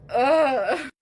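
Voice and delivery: loud, pained groan through tears; tearful groan. Real, not acted. Unable to keep talking on TikTok, groaning through tears. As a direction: let the crying break out in one sound. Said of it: "I try not to cry on TikTok because I can't talk".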